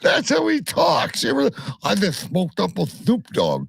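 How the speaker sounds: very high, lisping cartoon voice; Daffy Duck. Co-host defending the voice to the news reader, as Daffy Duck. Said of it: "did you just sound like Daffy Duck?".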